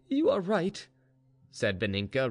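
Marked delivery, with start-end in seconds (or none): 0.1-0.9 high-pitched, decided agreement; regained resolution. A narrator's reading. Mistress accepts her maid's plan, resolute. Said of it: "recovering her usual resolution".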